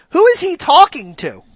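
ordinary talk

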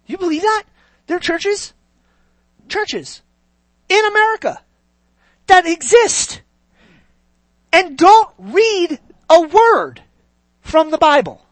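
rising preacher outrage. Acted.

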